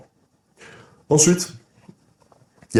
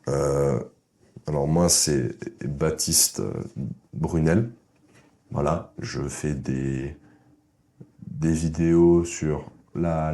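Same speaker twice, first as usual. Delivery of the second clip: slow, halting, lower and quieter, full of pauses; hesitant mumbled intro; 3 semitones lower, 4 dB quieter, much slower than usual. Shown as a demonstration. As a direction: stumble through it like a shy guy. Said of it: "Petite comparaison". (présentation hésitante et lente, voix basse)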